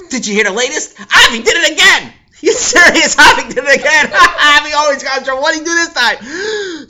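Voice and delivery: loud laughing gossip; excited gossip. Acted. Boys gossiping about Avi, excited. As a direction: spread the news gleefully.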